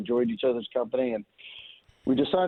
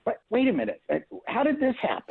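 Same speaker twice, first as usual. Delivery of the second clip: much higher, startled disbelief; retold surprise; 11 semitones higher than usual. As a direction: play it as caught totally off guard. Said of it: "I go".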